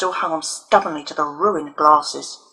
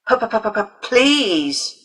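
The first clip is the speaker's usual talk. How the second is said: mocking stutter; 4 semitones higher than usual. A narrator's reading. Leo crowing, mocking Alison's stutter to her face. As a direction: crow the p-p-p-p-p- then spit out please. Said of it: "viciously mimicking Alison's stutter".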